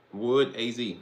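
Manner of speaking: ordinary talk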